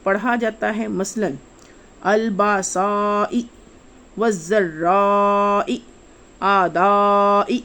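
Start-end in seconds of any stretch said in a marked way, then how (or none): 2.0-7.6 الباسائی والذرائی آدائی said slowly, each سا را دا vowel held four times long; slow recitation, each long vowel pulled out; fourfold madd. Shown as a demonstration. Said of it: "آواز کو چار گنا لمبا کر کے پڑھا جاتا ہے".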